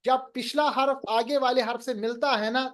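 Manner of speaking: usual speaking voice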